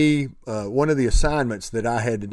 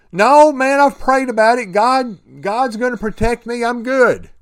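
high, louder brush-off of help; confident refusal; 8 semitones higher, 4 dB louder than usual. Retold or imitated. Man on roof turns down helicopter, confident refusal. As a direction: wave them off, sure of yourself.

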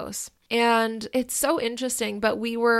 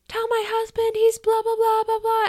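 much higher, loud hysterical yelling; irate ex-wife; 12 semitones higher, 4 dB louder than usual. Retold or imitated. Ex-wife repeating her demand in the driveway, shrieking high. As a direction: imitate her screaming the same demand again. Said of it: "she was so irate".

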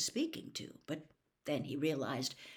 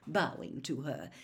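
ordinary delivery, nothing marked

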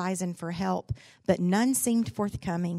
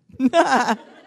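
high, loud burst of laughter; 6 semitones higher, 11 dB louder than usual. Real, not acted. Preacher's wife laughing at the congregation, loud laugh. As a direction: cackle with delight.

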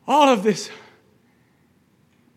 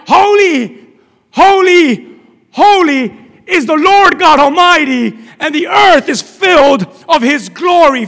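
loud, high, ringing praise; angels' proclamation; 5 semitones higher, 12 dB louder, slower than usual. Acted. Angels praise Father, Son and Spirit, loud and exalting. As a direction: cry out each "holy", then declare it forcefully. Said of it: "and the angels are like".

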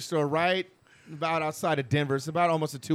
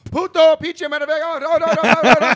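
high, loud mock cursing barrage; imitated Spanish cussing; 11 semitones higher, 12 dB louder than usual. Storyteller as his drunk brother cussing out a stranger, hammed-up shouting.

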